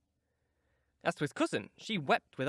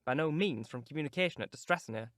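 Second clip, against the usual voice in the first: ordinary delivery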